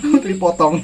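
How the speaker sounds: laughing while chewing. Real, not acted. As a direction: giggle through the words. Laughing at tough pineapple core.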